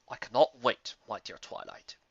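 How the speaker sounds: put-on a kindly old wizard; old stallion. Old unicorn voiced.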